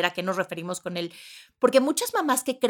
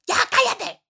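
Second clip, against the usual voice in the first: much higher mock snap; 14 semitones higher, 5 dB louder than usual. Mom yelling at kids, hammed-up snap. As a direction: bark it like a fed-up mom. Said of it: "o, o".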